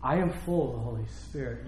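soft, low-pitched earnest appeal; hushed, measured insistence; Stephen's earnest plea. Retold or imitated. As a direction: drop low and quiet, asking to be heard. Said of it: "just a little bit of Stephen saying".

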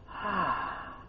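long soft sigh; relieved sigh. Acted. Preacher asking if they tried escaping, long relieved sigh. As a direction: breathe out as if finally away. Acts out getting away from everything.

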